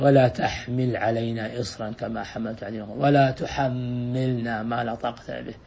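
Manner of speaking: soft, low prayerful recitation; hushed Quranic verse; quiet supplication. Shown as a demonstration.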